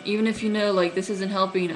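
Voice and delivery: ordinary talk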